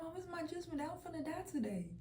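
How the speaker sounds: high, soft, smiling voice; eerily smiling. Retold or imitated. Telling mama it's judgment day, blissfully smiling. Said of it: "saying it with a big ass smile".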